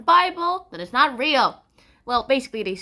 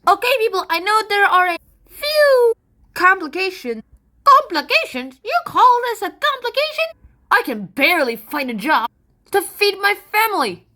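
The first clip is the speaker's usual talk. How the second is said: high, loud, hammed-up British accent; 7 semitones higher, 5 dB louder than usual. Pilgrim complaining about life in Holland, British accent. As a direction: complain indignantly in a put-on British voice. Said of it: "I'm talking less with a British accent".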